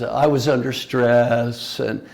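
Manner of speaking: slow, loud, self-justifying complaint; whiny excuses. Retold or imitated. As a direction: drag out the excuses defensively. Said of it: "they have great excuses".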